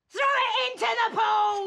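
high gleeful shout. Acted. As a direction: bark the order loudly. Gleeful order to trash the plant.

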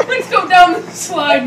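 ordinary talk

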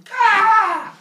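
very loud, high comic scream; scream of pain. Acted. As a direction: shriek as if suddenly speared. Father Sky hit by spear.

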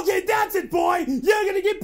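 high angry yelling; angry shouting. Acted. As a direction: shout the threat. Threatening the cat.

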